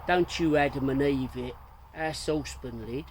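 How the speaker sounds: loud Cockney banter in rhyming slang. Acted. Soapy teasing Tommy, in Cockney rhyming slang. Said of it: "replied Soapy in rhyming slang".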